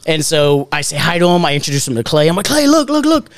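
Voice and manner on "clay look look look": high-pitched, acted-out drunken excitement; hammered excitement. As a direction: show off your friend, voice way up high. Drunkenly introducing old friend, retold.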